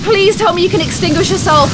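ordinary delivery

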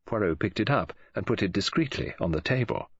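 ordinary delivery

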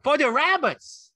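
very high, drawn-out excited shout; Lenny's joyful shout. A narrator's reading. Lenny thrilled about the rabbits, high shout. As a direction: shout it slowly with childlike glee. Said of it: "Lenny shouted".

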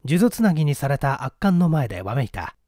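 plain reading voice

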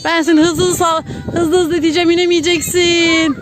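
high singsong threat, last word stretched; playful teasing. Pretend. Parent spinning a child who cannot get off, playful teasing.

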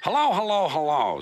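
the classic warning hello of a constable; ominous policeman. Acted. Policeman arriving at the quarrel, hammed-up ominous hello. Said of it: "said a policeman ominously".

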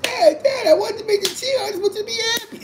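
very high, squeaky mock cry; crying daughter. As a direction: imitate a daughter wailing to dad. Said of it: "She cried".

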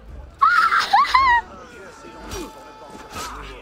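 loud squealing burst of laughter; real laughing shriek; high shrieking laugh. Real, not acted. Chaos in game.

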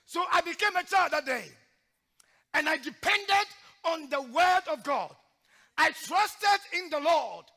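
loud, high preaching cry; preaching at full force. Acted. Preacher exhorting the church in a loud preaching cry. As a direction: drive each line home like a revival preacher.

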